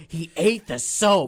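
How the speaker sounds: slow, mock-outraged mom. Arthur's mom complaining about Pal, mock outraged. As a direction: stretch it out as a retold outrage. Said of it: "outraged about this".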